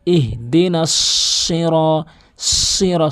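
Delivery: "সিরা" with a long heavy hissed s; emphatic sad. Shown as a demonstration. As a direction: hold the thick s leading into "সিরা". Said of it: "সাতের উচ্চারণটি". (ভারী সাদ দিয়ে "সিরা")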